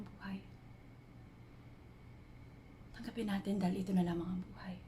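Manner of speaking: soft, hushed introspection with long pauses; half-whispered, searching confession, left to hang between thoughts; reflective dramatic monologue. Acted. Woman reflecting on life's purpose while climbing a mountain at night, hushed monologue.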